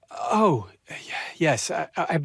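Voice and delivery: breathless, stammering whisper; nervous stammered whisper; flustered whisper. Acted. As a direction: caught out, stumble over the words under your breath. Caught off guard, flustered admission.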